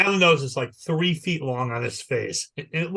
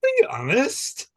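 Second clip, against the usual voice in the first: much higher, odd put-on "Are you honest?"; high comic voice; 11 semitones higher than usual. Husband with trunk asking wife, high comic voice. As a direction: ask it in a silly squeaky voice. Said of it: "he goes".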